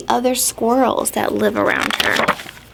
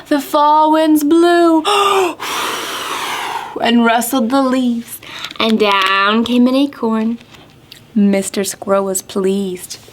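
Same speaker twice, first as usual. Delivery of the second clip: sing-song rhyme with gasping wind effects; counting rhyme for kids; 4 semitones higher than usual. Acted. Teacher reading a counting rhyme to children, sing-song. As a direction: read it like a children's counting game.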